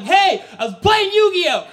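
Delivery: high, loud desperate explanation; acted frantic yell; shouted defence. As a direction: blurt it out at full volume.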